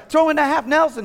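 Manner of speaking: loud high shout of the wrestling move; yelling coach. Retold or imitated. Dad yelling at his son mid-match. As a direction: call it hard across the mat. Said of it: "I'm yelling".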